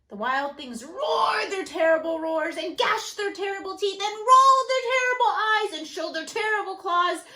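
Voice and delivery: loud, rising monster roar. Acted. Reading the wild things' anger, loud roaring. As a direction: roar it out furiously.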